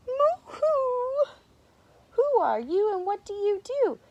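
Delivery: very high, loud friendly singsong; cheery high storybook greeting; nice voice. A narrator's reading. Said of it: "came a nice voice".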